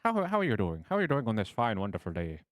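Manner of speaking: high, loud stage-Irish shopkeeper; a hammy Irish weapon seller greeting customers; bad Irish accent. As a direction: ham up a cheery Irish brogue. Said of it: "Any Irish people, I deeply apologize".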